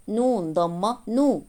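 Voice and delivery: usual speaking voice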